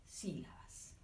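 whispered sílabas; teacher drops to a whisper for the class. Shown as a demonstration. Sílabas whispered softly.

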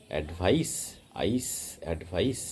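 অ্যাডভাইস ending in a voiceless s like আইস; voiceless s demo. Shown as a demonstration. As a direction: rhyme অ্যাডভাইস with আইস, hissing s. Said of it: "এস এর মতো আসবে, voiceless এস".

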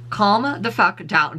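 very slow, word by word; slow emphatic. Acted. Scolding the copycat, slow emphatic. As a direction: spell each word out.

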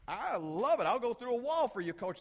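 loud, high mock-eager player; fired-up player. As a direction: shout it like a player pumped up for coach. Voices a player who loves yelling.

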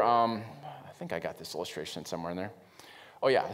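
usual speaking voice

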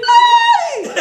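a very high laughing ay; squealing laugh. Acted. Storyteller squealing over the smoke, very high laughing cry. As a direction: squeal it with delight.

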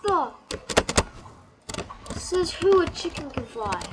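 high, loud shocked cry; startled outburst. Real, not acted. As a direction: blurt it out in disbelief. Shocked by flying chicken.

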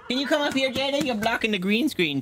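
high-pitched talk to a dog; coaxing pet talk. Real, not acted. Owner calling Jada away from the green screen, high pet talk. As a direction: coax the dog over, voice lifted high.

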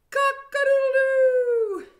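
high loud crowing call; rooster crow. Pretend. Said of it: "What are you gonna shout if you're a rooster?".

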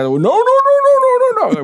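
high, loud, frantic repeated no; frantic shouting. Retold or imitated. Attendant running out at the pump, frantic high shout. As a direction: panic like the man is stopping a crime.

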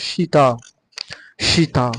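সীতা said "shee-taa"; soft "sh" opening on সীতা, shown for learners; correct soft SH. Shown as a demonstration. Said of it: "আমাদের এটা পড়তে হবে সি". (সীতা-র শুরুতে নরম "শ", "শীতা")

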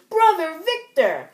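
high, loud, slow cry of "Brother Victor!"; calling out. Acted. Hero calling his brother in the comic, loud and high. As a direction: call your brother across the scene.

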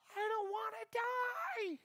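very high, drawn-out mock wail; far-away cry in falsetto; imitated scream. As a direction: squeal it slowly, imitating someone in panic. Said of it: "I could hear this sound".

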